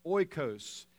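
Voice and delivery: oikos said OY-kohs, stress on the first syllable; Greek word oikos. Shown as a demonstration. Preacher teaching the congregation the Greek word, careful OY-kohs. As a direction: say oikos as OY-kohs, slow and clear. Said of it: "That word household in the Greek is".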